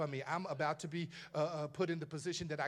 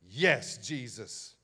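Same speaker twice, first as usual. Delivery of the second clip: Peter answering; 6 semitones lower, 5 dB louder, much slower than usual. Acted. Peter answers, slow and low.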